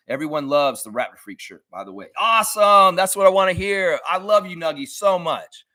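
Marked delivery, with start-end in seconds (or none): 2.2-5.6 high, loud happy praise; excited delight. Real, not acted. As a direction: gush over a friend's message. Delighted reply to friend's message.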